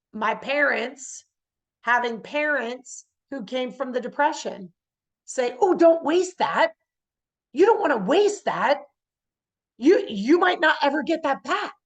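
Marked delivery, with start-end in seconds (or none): 5.6-11.7 higher, louder scolding warning; mock parental alarm; fretting parent. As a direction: caution them like a Depression-era parent. Voices parents' anxious warning.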